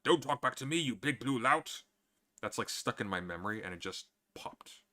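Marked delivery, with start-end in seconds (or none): 0.0-1.8 loud, higher theatrical villain scolding; imperious cartoon tyrant outburst; Jafar. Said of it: "Aladdin where Jafar refers to the genie".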